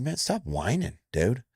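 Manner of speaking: stern coach. Retold or imitated. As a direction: snap him out of his whining. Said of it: "I said".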